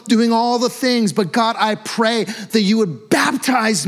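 loud, high, pleading cry to God; desperate hissy fit prayer. Shown as a demonstration. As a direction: cry out to God like you can't take it anymore. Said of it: "this phrase hissy fit is coming to mind".

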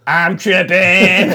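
loud, very drawn-out wail; tripped-out holler. Retold or imitated. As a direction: stretch it out like a helpless tripper. Retold helpless shout from sidewalk.

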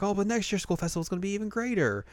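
high, quick gushing imitation; excited bandmate. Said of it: "it's like".

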